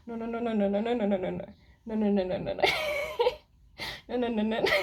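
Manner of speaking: giggling no no no, loud; laughing refusal. Pretend. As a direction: laugh through the no's. Refusing the spirit guide, laughing.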